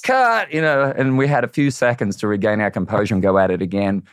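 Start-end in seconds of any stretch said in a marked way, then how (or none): 0.0-0.5 high sharp call; calling cut. Retold or imitated. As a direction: call it like a director. Calling halt.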